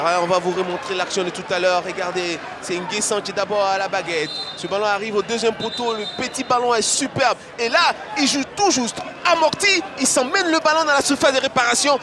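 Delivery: loud replay commentary. Real, not acted. Replay analysis over crowd.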